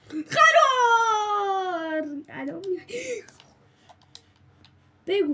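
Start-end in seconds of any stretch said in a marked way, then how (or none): 0.1-2.0 long drawn-out scared shout; panicked cry. Real, not acted. Gamer fleeing danger, crying out in drawn-out panic.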